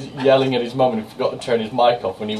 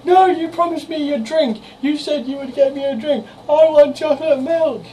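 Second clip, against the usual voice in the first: put-on much higher whiny kid demands; high-pitched kid voice; 3 dB louder than usual. Boy whining to mum that she promised him chocolate milk, high-pitched. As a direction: whine like a kid who was promised a drink. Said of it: "it was the high-pitched voice".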